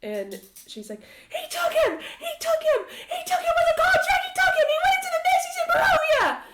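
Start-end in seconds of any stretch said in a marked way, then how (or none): 1.3-6.4 frantic, very high full-force scream. Acted. Mother finds Lucien nowhere to be found, frantic screaming. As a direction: scream it over and over, hysterically. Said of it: "she starts screaming".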